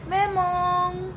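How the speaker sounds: high, loud sing-song name call; playful call. Real, not acted. Mom calling out to her pet, high and loud.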